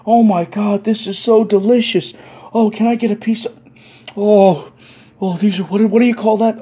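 delighted raving. Retold or imitated. Newcomer tasting cookout food, delighted. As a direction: gush over every bite.

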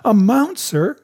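high, loud "Amount, sir?"; incredulous cry. A narrator's reading. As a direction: echo the word in disbelief. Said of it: "cried the squire".

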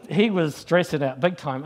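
normal talking voice

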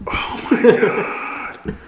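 whispered through laughter, slow; wheezing with laughter; breathless laugh. Real, not acted. Laughing at the naked couple.

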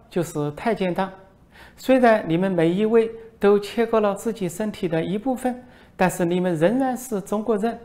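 high, put-on voice of a foreign reporter; mocking reporter voice. Foreign reporter needles the minister, high mocking voice. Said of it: "外国记者说".

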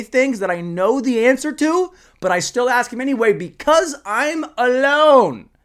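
high, building comic rant; mock despairing yell. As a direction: build to a long, loud wail of loneliness. Mock outburst at himself for podcasting alone.

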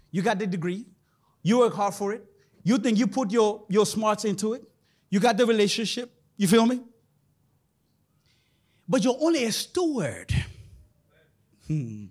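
ordinary delivery

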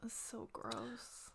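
soft, slow whisper; whispered disgust. Real, not acted. Listener whispers slowly, grossed out by the story. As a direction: barely breathe the revulsion.

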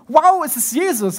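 amazed realisation. Acted. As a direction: disciples at the table realise it is Jesus, in amazed awe. Disciples realise it is Jesus.

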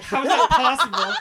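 high, loud, laughing disbelief. Retold or imitated. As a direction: laugh it out in shock. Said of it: "I'm like".

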